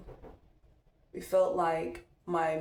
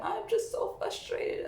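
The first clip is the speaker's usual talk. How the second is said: put-on high, drawn-out kid voice; slow, high mock frustration; imitating young son; 12 semitones higher, slower than usual. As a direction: mimic a child's complaint, pitched way up. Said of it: "'cause he like".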